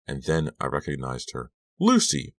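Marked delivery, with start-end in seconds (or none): 1.8-2.3 high, loud cry of recognition; startled cry. A narrator's reading. Girl recognizes the person behind the straw, startled high cry. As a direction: cry out in astonishment. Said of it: "I cried".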